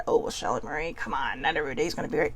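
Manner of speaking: low, hushed, quick mock objection; imitated doubter. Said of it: "I don't want people to think that".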